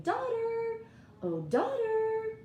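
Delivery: high, loud, slow sing-song call; wife calling daughter. Acted. Wife calling daughter to help pull, slow high call. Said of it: "she's saying".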